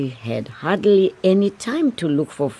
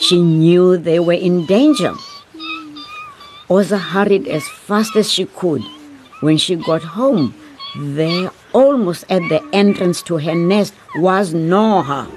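louder, urgent storytelling; tense narration; 5 semitones higher, 4 dB louder than usual. A narrator's reading. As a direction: build the danger. Narration builds to danger.

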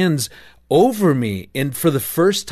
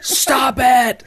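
sudden high loud yell; yelling stop; 13 semitones higher, 5 dB louder than usual. Retold or imitated. Husband yelling at wife mid-dream, sudden loud yell. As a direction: shout it out to cut her off. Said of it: "Like, I yell out".

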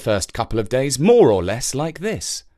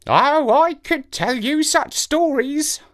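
slow high crow voice; boastful ancient crow; raspy cawing crow; 14 semitones higher, slower than usual. Acted. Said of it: "said the crow".

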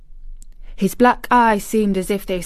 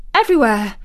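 gasped; 3 semitones higher, 6 dB louder, faster than usual. A narrator's reading. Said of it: "gasped Mary".

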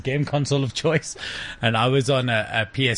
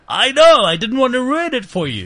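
much higher and louder, teasing; a friend gleefully admitting a prank; 12 semitones higher, 7 dB louder than usual. Retold or imitated. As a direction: crow that you tricked him. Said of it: "He said".

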